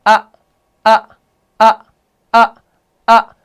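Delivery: short, abrupt "अ", each set apart by a pause; hamza articulation demo. Shown as a demonstration. Teacher showing students where hamza comes from, hamza articulation demo. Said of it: "ये हमजा का जो है मखरज है".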